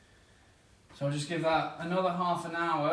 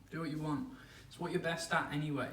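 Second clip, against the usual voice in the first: ordinary talk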